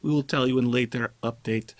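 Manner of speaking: mock Russian accent with a comrade wink. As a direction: read the dev notes in a joke Russian accent. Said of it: "I should read it in a Russian accent".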